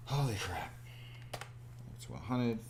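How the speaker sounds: ordinary talk, nothing marked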